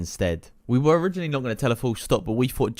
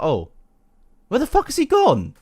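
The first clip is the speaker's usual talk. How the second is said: much higher mock baffled voice; baffled girl; 12 semitones higher than usual. Mocking sister's confusion over missing lizard, much higher. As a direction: squeak out the confusion.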